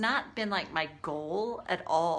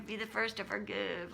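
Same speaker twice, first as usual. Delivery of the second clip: soft, sing-song mockery of a spiritual rule; mock the saintly advice, hushed; mocking the pious rule; 5 dB quieter than usual.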